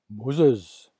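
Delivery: loud booming call from the bush; God calling Moses from the burning bush. Acted.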